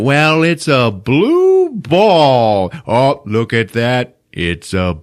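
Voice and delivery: slow, drawn-out mock wonder; hammed-up dad admiring gift; slow mock delight; imitating dad. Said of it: "He opens it".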